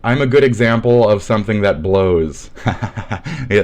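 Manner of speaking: ordinary talk, nothing marked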